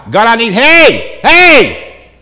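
loud, high cry for attention; desperate yell. Acted. David in trouble calling on God, desperate yell. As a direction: yell out to be heard. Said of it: "When the yell is in desperation".

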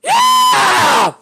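high, loud, drawn-out victory cry; triumphant shout. Real, not acted. Gamer who just beat the boss, triumphant shouting. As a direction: shout each word in triumph.

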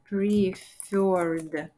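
"preferred" stretched syllable by syllable; slow dictation. Shown as a demonstration. Teacher writing out the word for learners, slow dictation. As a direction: draw out each sound of "preferred".

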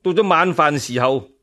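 ordinary delivery

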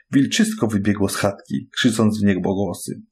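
ordinary delivery, nothing marked

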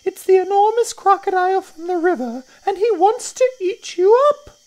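very high, loud bird shriek; high-pitched urgent bird warning; Roly-Poly Bird. A narrator's reading. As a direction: screech an alarm, drawing out the words. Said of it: "sang the roly-poly bird".

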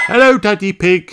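a cheerful rabbit lady; Miss Rabbit. A narrator's reading. Miss Rabbit greets the children, high chirpy voice. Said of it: "says Miss Rabbit".

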